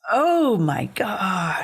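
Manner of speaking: high, drawn-out disbelief; slow, aghast reaction; appalled exclamation. Real, not acted. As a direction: stretch it out in real shock. Appalled at the retold call.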